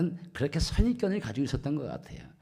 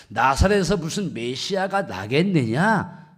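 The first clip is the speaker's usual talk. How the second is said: retold rebuttal, louder on a scornful question; retorting; 4 semitones higher, 7 dB louder than usual. Nathanael answers the news of a Messiah, retorting loudly. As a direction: throw the doubt back forcefully, as if quoting. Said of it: "반박한 겁니다".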